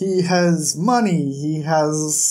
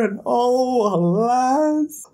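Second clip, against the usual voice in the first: hammed-up high sighing alas; mock lament; 6 semitones higher, much slower than usual. Vlogger mocking the awkward couple, hammed-up high lament. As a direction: play the tragedy for laughs, high. Mock-tragic sigh repeated.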